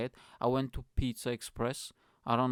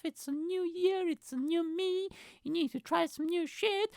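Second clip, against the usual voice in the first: high nagging sing-song parody; mock the self-help crowd, way up high; high sneering impression of change fans; mocking preachy voice. Mocking people who preach change.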